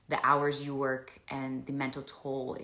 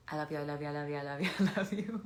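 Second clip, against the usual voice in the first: voicing the dog; faster than usual. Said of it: "I hope he would say".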